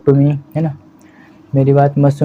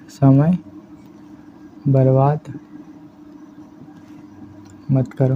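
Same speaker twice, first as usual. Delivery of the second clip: slow dictation; 6 dB quieter, slower than usual. Shown as a demonstration. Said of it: "लिखना होता है".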